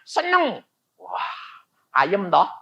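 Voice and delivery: ordinary delivery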